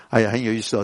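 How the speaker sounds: ordinary delivery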